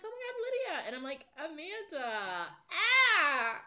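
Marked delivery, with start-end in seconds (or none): 0.0-0.9 very high, excited imitation of a greeting; mock gushing greeting. Acted greeting from mom at store. 1.4-3.6 high, loud squeal with drawn-out words; hammed-up gushing greeting. Acted over-friendly greeting back.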